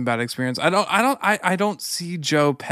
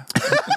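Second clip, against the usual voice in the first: high laugh. Real, not acted. Laughs at own slip.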